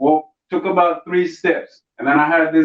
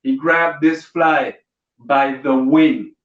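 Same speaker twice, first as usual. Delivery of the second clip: normal talking voice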